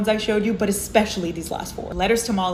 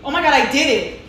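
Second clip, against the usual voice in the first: excited; 6 semitones higher, 5 dB louder than usual. Real, not acted. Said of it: "repeated excited".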